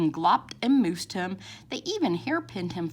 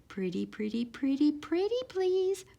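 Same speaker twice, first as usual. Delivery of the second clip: coaxing plea; 6 semitones higher, 5 dB quieter than usual. A narrator's reading. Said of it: "said Mom".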